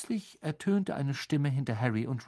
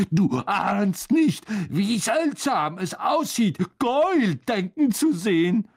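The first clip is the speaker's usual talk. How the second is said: high, louder character voice full of wonder; amazed; 8 semitones higher, 4 dB louder than usual. A narrator's reading. Ron gapes at his transformed friend, high and amazed. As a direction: marvel at it, pitch well up. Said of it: "der Harry sprachlos angestarrt hatte".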